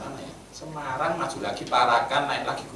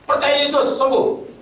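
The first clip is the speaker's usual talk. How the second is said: high, loud preacher cry; mock preacher shout; 10 dB louder, slower than usual. Pendeta berseru di Singapura. (teriakan pendeta yang ditirukan)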